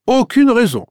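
high-pitched indignant echo; exclaiming. A narrator's reading. Commissaire answering Catherine's refusal, exclaiming. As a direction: leap up in pitch as if taken aback. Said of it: "s'exclama le commissaire".

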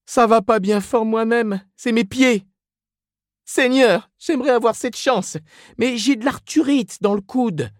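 very high put-on women's voices; complaining gossips. A narrator's reading. Two women trade aches at bakery counter, complaining. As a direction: moan about ailments in a high falsetto.

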